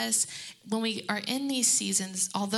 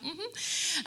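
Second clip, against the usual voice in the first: Jesus's knowing mm-hmm; 8 semitones higher, 4 dB quieter than usual. Retold or imitated. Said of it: "And he's like".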